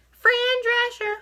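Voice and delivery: N=Fran Drescher/public. Acted. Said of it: "I sound like the nanny when I talk like that".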